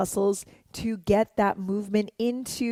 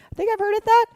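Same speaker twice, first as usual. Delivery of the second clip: sudden high; 13 semitones higher, 6 dB louder, much faster than usual. Real, not acted. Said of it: "sheepish aside, jumps high".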